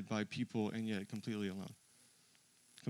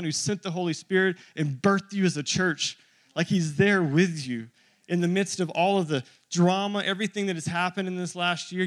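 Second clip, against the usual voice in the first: louder, higher, driving pulpit voice; full-force preaching; 6 semitones higher, 9 dB louder than usual. Acted. Pastor preaching to his church at full force. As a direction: build it up and preach it hard.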